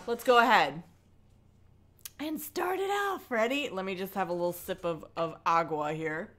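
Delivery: normal talking voice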